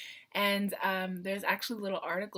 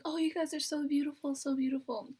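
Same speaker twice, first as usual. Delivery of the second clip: imitating fans; 7 semitones higher than usual. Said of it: "They're like".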